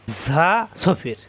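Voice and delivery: ঝা drawn out very slowly with a hiss before সফির; whistling letter demo. Shown as a demonstration. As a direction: stretch the ঝা into a long sibilant, then সফির. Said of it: "জিহ্বা নিশ্চিততে চাপ দিয়ে উচ্চারণ করতে হয়".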